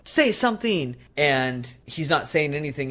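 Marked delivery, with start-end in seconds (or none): 0.0-1.0 mock demand.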